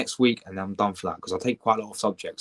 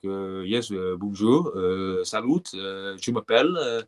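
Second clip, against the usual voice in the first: slow French accent with hesitant uhs; put-on French accent; 2 semitones lower, slower than usual. Shown as a demonstration. Streamer showing his exam tip, French accent. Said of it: "Speak in a French accent".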